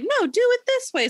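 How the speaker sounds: very high bossy-sister imitation; Emmy. Host mimics the older sister correcting everyone at dinner, high mock bossiness. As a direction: squeal the correction like a pushy older sibling. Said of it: "she's like".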